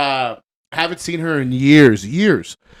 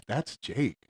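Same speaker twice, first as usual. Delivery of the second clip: keeping it to herself; 11 dB quieter than usual. Retold or imitated. Wife avoiding an argument with her husband, quiet private realization. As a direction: say it under the breath, much softer. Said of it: "she's not saying it 'cause".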